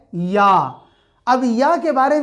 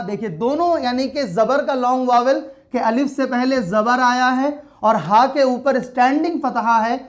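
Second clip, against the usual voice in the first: normal talking voice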